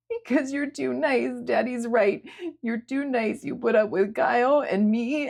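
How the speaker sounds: high, slowed, choked-up voice; pleading through tears; tearful young woman. A narrator's reading. Sierra explaining her guilt to Julia, tearful.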